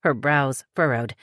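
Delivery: ordinary delivery, nothing marked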